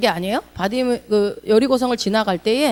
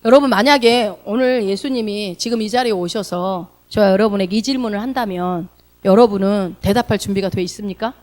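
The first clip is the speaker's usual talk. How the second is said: ordinary delivery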